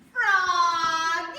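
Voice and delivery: long loud high call across the house; father calling his son loudly. Acted. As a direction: call Froggy from far away, loud and drawn out. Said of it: "called his father".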